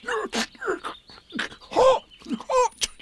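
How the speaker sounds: high, pained yelps through a burnt mouth; Tigger, mouth stinging, whimpers; mumbled in pain. A narrator's reading. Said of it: "mumbled Tigger".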